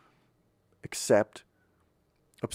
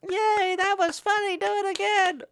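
put-on very high squeaky children cheering; squeaky kids voice. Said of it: "the kids will laugh".

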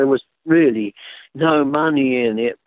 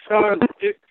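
talking around a guitar pick; words blurred by a pick in the mouth; mouth full; 6 semitones higher than usual. Real, not acted. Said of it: "I've got a guitar pick in my mouth".

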